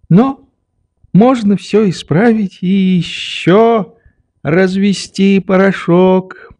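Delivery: put-on high, slow old man's voice; methodical old doctor. A narrator's reading. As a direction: chide placidly in a high, drawn-out voice.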